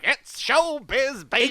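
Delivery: loud, high, drawn-out showbiz showman; hammed-up awards host, stretched and belted; Billy Crystal. Podcaster mocking an Oscars opening, hammed-up showman voice. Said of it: "it's like Billy Crystal".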